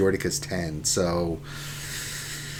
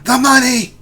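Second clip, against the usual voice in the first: high, loud cry of the money; imitating Urz's money yell; mock catchphrase yell; 13 semitones higher, 8 dB louder than usual. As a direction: shout it like Urz in a panic. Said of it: "That's what I love about Urz".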